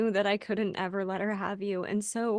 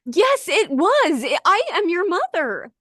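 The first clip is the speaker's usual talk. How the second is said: insistent; 5 semitones higher, 8 dB louder than usual. Acted. Mother insisting to her daughter she is her mother. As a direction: plant your feet and claim her.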